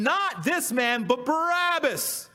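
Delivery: high, slow shouted demand for Barabbas; crowd crying out. Acted. Crowd answers Pilate, demanding Barabbas instead, in a high slow shout. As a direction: shout it like the whole mob. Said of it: "Then they all cried again".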